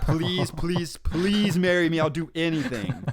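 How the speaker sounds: high, drawn-out fake pleading; mock begging. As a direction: beg in a hammed-up desperate whine. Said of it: "and I beg, and I yell, and I scream".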